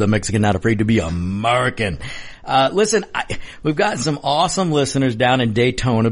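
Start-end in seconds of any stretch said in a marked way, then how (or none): 0.0-2.0 radio host welcome. Acted. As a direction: welcome listeners back like a host. Host returns from break.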